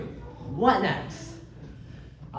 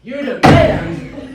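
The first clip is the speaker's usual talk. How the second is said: slow, incredulous, louder and higher; outraged; 5 semitones higher, 12 dB louder, much slower than usual. Acted. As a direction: spit the words back in disbelief. Magistrate outraged at lawyer.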